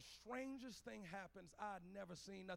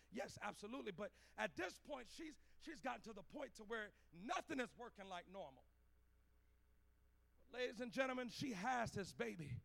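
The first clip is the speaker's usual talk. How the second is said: ordinary talk, nothing marked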